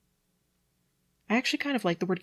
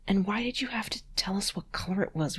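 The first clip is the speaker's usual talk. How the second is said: whispered complaint; 4 semitones higher than usual. Real, not acted. Exasperated aside at author.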